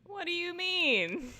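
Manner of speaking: high, slow mocking repeat; mocking echo. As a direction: drag it out in disbelief. Echoing game line mockingly.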